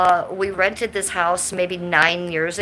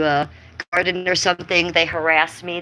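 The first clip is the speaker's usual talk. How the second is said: ordinary talk, nothing marked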